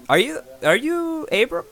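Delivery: high, loud eager question; excited recognition. Acted. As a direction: squeak out the question in sudden excitement. Smith suddenly recognizes the hero.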